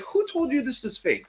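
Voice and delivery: high, startled question; shocked disbelief. Retold or imitated. To the crying customer, a high shocked disbelief. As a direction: sound floored that anyone called it fake. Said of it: "and I'm like".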